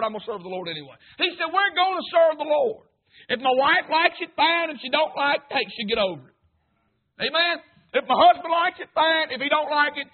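loud, high, driving sermon cadence; full-force preaching. Acted. Preacher urging the congregation to serve the Lord, full-force. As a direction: preach it out to the back pews.

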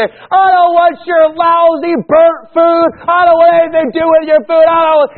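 high, loud, scornful refusal; rude rejection. Pretend. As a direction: spit it back like an insulted guest. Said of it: "reject it in a good or bad way".

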